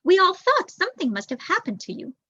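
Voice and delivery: chatty lady mouse, pitched well up; eager neighbour calling out her surprise; Janice the neighbour. A narrator's reading. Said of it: "she said".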